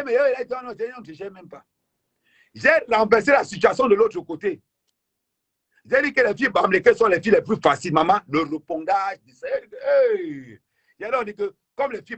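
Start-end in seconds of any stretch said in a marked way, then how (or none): 0.0-1.6 put-on high, scoffing girl voice; dismissive mock reaction. Imitating the girls' dismissive reaction. 9.4-10.6 high squealed hey of outrage; outraged cry. Retold or imitated. Imitating the outraged reaction.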